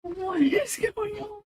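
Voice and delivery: high, squeaky words through real laughter; baffled, words swallowed by giggles; helpless laughing. Real, not acted. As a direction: struggle to talk through a laughing fit. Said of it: "I don't know why I'm laughing".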